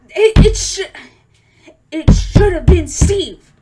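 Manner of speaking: high, loud, slow protest; indignant outburst. Real, not acted. Fan insisting Steve should have died, loud and frustrated.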